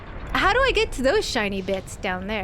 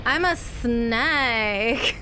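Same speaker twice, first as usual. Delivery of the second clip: slow drawn-out snake voice, stretching every sound; hammy slow snake impression; much slower than usual. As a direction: play a slithering snake, very slow. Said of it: "You know? Do you ever do that?".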